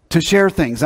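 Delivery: usual speaking voice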